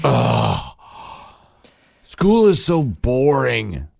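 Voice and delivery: put-on high, loud, slow whine of a bored child; dad mimicking his son's loud, slow whine; whiny kid complaint. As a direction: drag it out like a sulky boy. Son complaining, voiced by dad.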